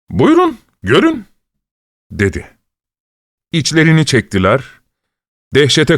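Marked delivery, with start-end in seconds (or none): none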